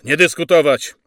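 loud, forceful lecturing; sergeant's harangue. Acted. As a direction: silence the men and lay down orders. Sergeant lectures his men.

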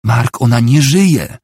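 desperate plea to face the truth; despairing cry. A narrator's reading. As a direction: cry it out, drawing out the words. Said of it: "krzyknęła w rozpaczy Nicole".

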